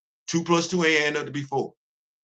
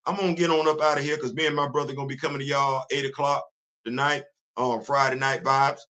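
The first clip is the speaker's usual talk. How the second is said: usual speaking voice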